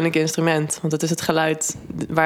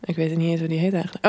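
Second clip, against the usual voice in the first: usual speaking voice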